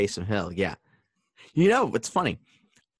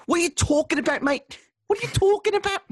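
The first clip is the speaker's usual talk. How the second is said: high-pitched, put-on Scouse; Liverpool accent; 4 dB louder than usual. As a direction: squawk it like an indignant Liverpudlian. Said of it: "I could be from Liverpool".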